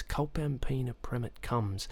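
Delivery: very soft, slow recital; mouthed softly. A narrator's reading. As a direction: sound the words out under your breath. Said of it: "mouthing the words".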